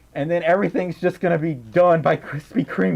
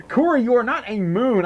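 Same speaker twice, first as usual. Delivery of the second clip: ordinary talk, nothing marked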